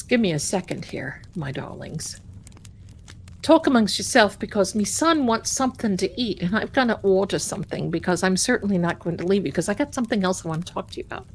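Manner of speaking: ordinary talk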